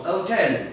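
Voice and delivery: HOTEL without its h, oh-TEL; dropped h. Retold or imitated. Teacher shows how the British said hotel, dropped h. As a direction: leave off the h: 'otel. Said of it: "could not pronounce the sound h properly".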